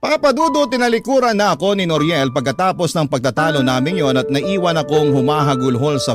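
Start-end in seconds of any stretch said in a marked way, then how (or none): none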